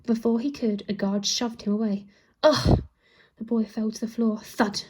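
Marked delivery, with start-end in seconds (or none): 2.4-2.8 louder pained grunt; knocked-down grunt. A narrator's reading. Boy shoved by a guard, louder grunt.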